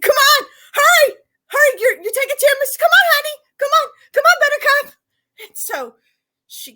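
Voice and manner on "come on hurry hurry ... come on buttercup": high, loud sing-song hurry-up; loud embarrassing mom call. Retold or imitated. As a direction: holler sweetly to embarrass her. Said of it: "I start honking the horn".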